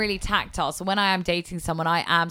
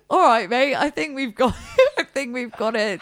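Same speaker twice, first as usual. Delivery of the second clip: higher, laughing through the words; laughing retort; 5 semitones higher than usual. Retold or imitated. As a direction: laugh while telling him off. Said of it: "And I was like".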